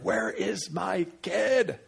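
high, drawn-out frantic parent; mock freaking out. Parent imagining a lost child, mock freaking out. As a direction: stretch it out in playful panic. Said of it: "I'd be freaking out".

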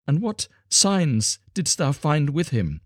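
high, slow, pressing question; urgent cry. A narrator's reading. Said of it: "she cried".

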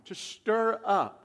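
"to stir up" drawn out slowly; slow stress. Shown as a demonstration. Preacher defining the word, "to stir up" said slowly. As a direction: stretch "to stir up" out. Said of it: "The word irritate".

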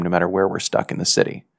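ordinary delivery, nothing marked

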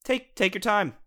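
high, easygoing chill guy; laid-back mentor voice. Retold or imitated. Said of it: "They're just like".

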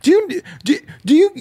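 ordinary delivery

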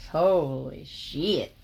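slow, drawn-out amazed curse; stunned reaction. Real, not acted. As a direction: let it fall out of you, floored. Reacting to the cowboy's line.

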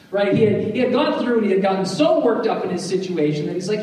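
ordinary talk

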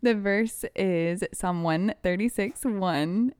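ordinary talk, nothing marked

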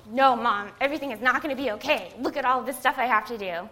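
loud, fast, exasperated protest; arguing with mom. Retold or imitated. Daughter protesting to her mom about her to-do list, loud and exasperated. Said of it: "I tried to argue with her".

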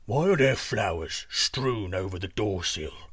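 slow, low giant voice; questioning giant. Acted. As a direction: ask it ponderously as a deep giant. Giant questions princess in bed.

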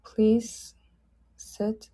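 ordinary talk

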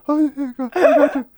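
high-pitched mock reassurance; bystander impression. Onlooker agreeing to call cops, high imitated reply.

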